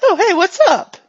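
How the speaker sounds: high, loud, bouncy hello; chipper character greeting. Acted. Game master repeats looping character's greeting.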